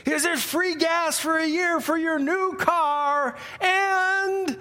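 high, drawn-out prize list; game-show announcer. Retold or imitated. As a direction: build up each prize like a TV host. Acting the announcer listing more prizes.

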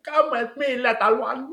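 high, sly mock vampire lure; hammed-up high sinister invitation; sinister vampire woman. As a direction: coax the child in like a creepy vampire lady. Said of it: "the way she's like".

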